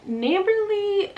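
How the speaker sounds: high, drawn-out disbelieving echo; mock incredulous. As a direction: throw the word back in amused disbelief. Mocking the neighbor's word back.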